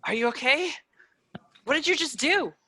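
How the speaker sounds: high, shaken questions; breathless fear after the attack; terrified girl asking her rescuer what happened; frightened. Acted. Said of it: "a look of absolute fear".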